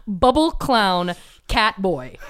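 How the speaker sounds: spaced-out word-by-word quote; slow deliberate repeat. Retold or imitated. Podcaster repeats stranger's words to co-host, slowly and deliberately. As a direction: draw each word out for comic emphasis.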